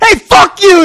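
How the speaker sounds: high, loud angry shout; screamed. Retold or imitated. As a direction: scream it back at someone who shoved past. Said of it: "I fucking screamed it".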